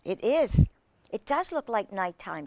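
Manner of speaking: ordinary delivery, nothing marked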